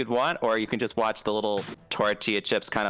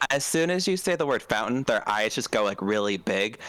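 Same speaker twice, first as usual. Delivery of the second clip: ordinary delivery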